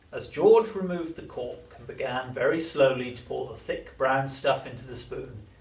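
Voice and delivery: ordinary delivery, nothing marked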